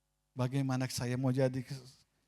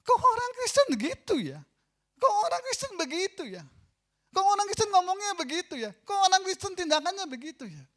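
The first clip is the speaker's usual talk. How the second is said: much higher and louder, scandalized repeated question; scandalized outcry; 8 dB louder than usual. Pretend. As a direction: shout it like shocked onlookers. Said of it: "orang sudah bisa nilai kita".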